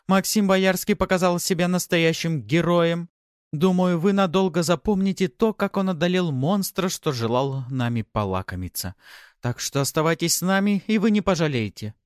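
bright on-camera news delivery; high, loud anchor voice; TV reporter voice. A narrator's reading.

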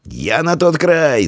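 high, stretched-out call. A narrator's reading. Boy telling friend where he swims, shouting. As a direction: call slowly across the water, pitched high. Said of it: "закричал Диан".

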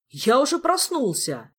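high, loud, slow protest; shouting. A narrator's reading. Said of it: "тут же закричал тот".

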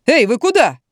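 calling after. A narrator's reading. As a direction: call out to stop someone leaving. Doctor stops fleeing patient. (окликнуть уходящего)

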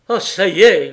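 a loud, angry groan of defeat; growled outburst. Acted. Engine driver stuck in the snow, loud angry growl. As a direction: snap it out as the engine dies. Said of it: "gronda Jacques".

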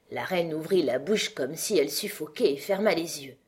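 ordinary delivery, nothing marked

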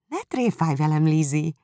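laughing it off in disbelief; Jane disbelieving. Acted.

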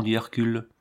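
plain reading voice

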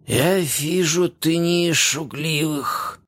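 slow, careful broken-jaw words; forcing each word out through a broken jaw; slurring zombie voice. A narrator's reading. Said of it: "стараясь максимально чётко сформулировать слова".

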